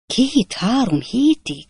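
astonished exclamation. A narrator's reading. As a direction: gasp it out in amazement. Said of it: "kiáltott fel Pickwick úr álmélkodva".

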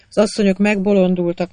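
ordinary delivery, nothing marked

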